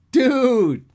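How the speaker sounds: very high, loud, stretched exclamation; shocked shout. A narrator's reading. Dan reacting to his friend's dirty talk, shocked shout. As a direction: cry it out long, scandalized.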